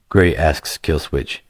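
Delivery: ordinary delivery, nothing marked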